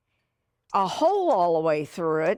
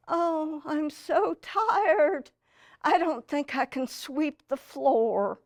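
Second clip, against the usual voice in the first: high, slow put-on tired moan; fake tired whine; 7 semitones higher, slower than usual. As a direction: pretend to be worn out to dodge sweeping. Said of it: "I'm gonna have to say to the camera guy".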